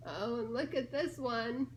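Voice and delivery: usual speaking voice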